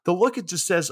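normal talking voice

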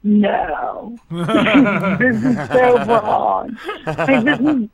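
laughing through the words, slow and drawn out; laughing disbelief. Retold or imitated. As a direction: chuckle as you read the script in horror. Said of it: "I was like".

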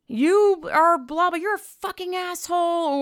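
high, loud, drawn-out sneering caricature; mock woke scold. Parodying woke commenters online, high, loud mocking whine. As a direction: lampoon the shrill commenter, stretched out.

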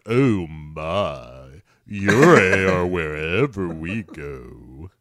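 low, slow, drawn-out celebrity imitation; deep mock-ominous pronouncement; George Takei. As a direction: draw every word out portentously. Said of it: "is telling George Takei".

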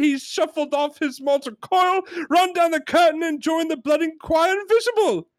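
high-pitched exasperated rant; hammed-up outrage, every phrase hammered out high; John Cleese yelling. Customer ranting that the parrot is dead, exasperated high yell. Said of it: "and he yells a lot".

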